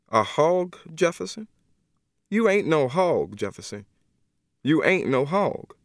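begging him to see he's a man; Miss Emma pleading. Acted.

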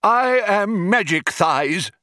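slow, high proclamation; grand announcement. A narrator's reading. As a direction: announce your name to the whole room. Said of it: "announced the older one".

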